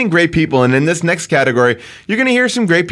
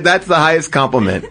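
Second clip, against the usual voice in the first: ordinary talk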